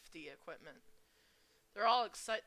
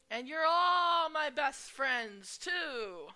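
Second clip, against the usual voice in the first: gushing; 7 semitones higher, 10 dB louder, much slower than usual. Acted. Group friendship moment.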